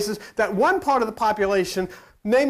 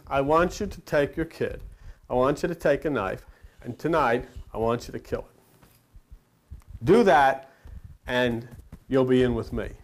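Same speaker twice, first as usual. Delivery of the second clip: grave God voice; 6 semitones lower than usual. Acted. God commands the listener.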